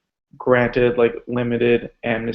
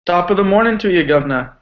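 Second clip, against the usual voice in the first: hammed-up British-Irish greeting accent, higher and quick; terrible mock accent; 6 semitones higher, 4 dB louder, faster than usual. Guest greets the podcast host in a terrible put-on accent. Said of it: "That was a terrible accent".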